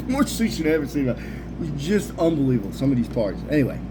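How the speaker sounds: ordinary talk, nothing marked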